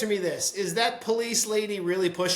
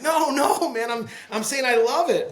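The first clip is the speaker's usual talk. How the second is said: high, loud placating protest, imitated; backpedalling counterman; 7 semitones higher, 5 dB louder than usual. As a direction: hurry to take back the offence. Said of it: "And the guy was like".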